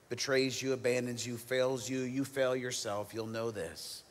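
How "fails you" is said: ordinary delivery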